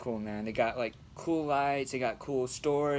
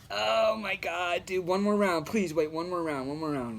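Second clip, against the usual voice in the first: higher, louder begging that fades; pleading; 6 semitones higher, 4 dB louder than usual. Retold or imitated. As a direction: plead for one more round, rising then settling. Begging friend to wait longer.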